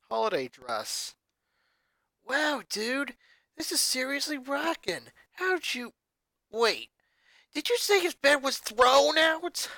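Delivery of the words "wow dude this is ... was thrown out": put-on higher, casual rocker girl, turning alarmed at the end; a lightly voiced cool teen, suddenly catching on; laid-back rocker girl. A narrator's reading. Luna voiced in story.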